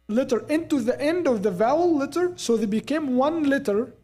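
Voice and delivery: ordinary talk, nothing marked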